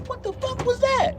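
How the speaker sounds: mock high shriek of shock from the lady. Startled woman at Walmart, high mock shriek. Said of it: "That lady was like".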